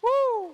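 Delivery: very high, loud woo; cheering whoop. Real, not acted. Pastor whooping to start applause for the Lord. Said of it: "Palakpakan natin ang Panginoon".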